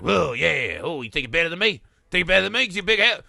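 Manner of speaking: tough-talk in a put-on Australian accent; mock Australian accent. Cop taunting the ginger in a mock Australian accent. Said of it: "he's Australian now".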